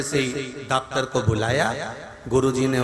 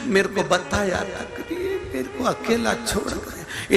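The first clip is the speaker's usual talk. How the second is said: mock wailing; 7 semitones higher than usual. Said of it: "क्यों रो रही है?".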